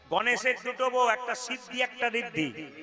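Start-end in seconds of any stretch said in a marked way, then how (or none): none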